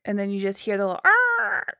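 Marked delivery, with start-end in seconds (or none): 1.1-1.7 high, crow-like zombie screech; zombie sound. Retold or imitated. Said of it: "that was my zombie sound".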